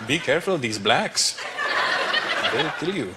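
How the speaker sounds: put-on slow Indian-accented warning; Indian parent. Parents warning their child, slow accented caution. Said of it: "what my parents have told me".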